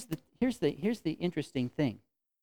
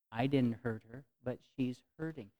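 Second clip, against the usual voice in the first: ordinary talk, nothing marked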